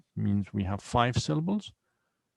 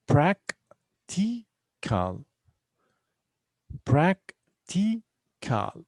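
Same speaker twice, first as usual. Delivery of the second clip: "Practical" very slow, in three separate beats; prac, ti, cal with long gaps in "Practical"; syllable by syllable; 7 semitones higher, much slower than usual. Shown as a demonstration. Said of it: "we have three syllables here".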